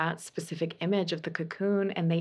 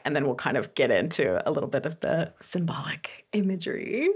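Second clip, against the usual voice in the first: whisper while shuffling; 2 semitones lower than usual. Real, not acted. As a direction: whisper as you focus on the cards.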